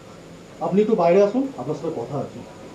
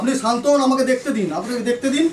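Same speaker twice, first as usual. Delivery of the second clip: raised, high urgent call to calm down; loud command; 8 semitones higher, 4 dB louder than usual. Acted. Doctor loudly ordering the frantic son to calm down. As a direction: stop him with a loud order. Doctor demanding room to examine.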